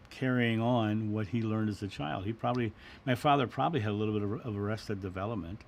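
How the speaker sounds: usual speaking voice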